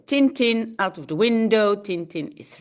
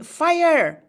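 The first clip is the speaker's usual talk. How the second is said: mock scream; 7 semitones higher, 3 dB louder than usual.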